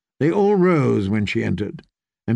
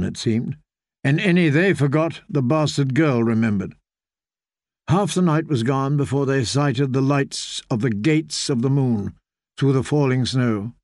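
ordinary delivery

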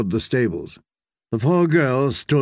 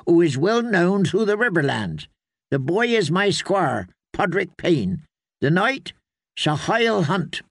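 higher, measured character voice; formal introduction, pitched up; courteous traveller; 6 semitones higher than usual. Acted. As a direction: present your companions formally to strangers.

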